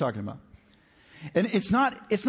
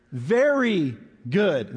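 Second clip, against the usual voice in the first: "very" slow and a bit louder, leaning on it; stress on very; 3 dB louder, much slower than usual. Shown as a demonstration. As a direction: draw out "very" in "very good". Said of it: "That's, that's the word, very".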